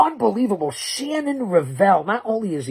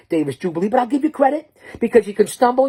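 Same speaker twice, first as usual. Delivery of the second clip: ordinary talk, nothing marked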